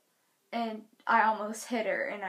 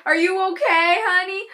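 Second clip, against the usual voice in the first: high, loud fussing question; mock concern; 7 semitones higher, 9 dB louder than usual. What the dad should have asked, loud mock concern. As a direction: act out exaggerated sympathy.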